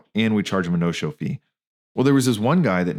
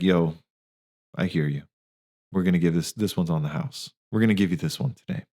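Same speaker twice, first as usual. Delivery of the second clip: softer, gentle reassuring tone to a struggling patient; kind reassurance; 3 semitones lower, 3 dB quieter than usual. Retold or imitated. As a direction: quietly tell him it's on the house. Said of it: "my staff was like".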